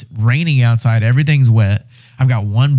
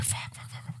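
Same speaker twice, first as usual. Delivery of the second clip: whispered cursing; 25 dB quieter than usual. Retold or imitated. Coal not lighting, panicking.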